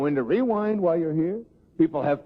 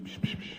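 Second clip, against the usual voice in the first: imitated gossip whispers, breathy psh psh; whispered psh sounds; 12 dB quieter than usual. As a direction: mimic people whispering behind your back. Imitating people whispering about him.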